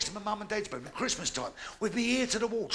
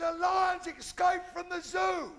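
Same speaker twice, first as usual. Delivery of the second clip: high, louder, slow shouted lines; hammed-up panicky bellowing; panicked shout; 9 semitones higher, 3 dB louder, slower than usual. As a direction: yell like a man fleeing. Panicked runner shouts story.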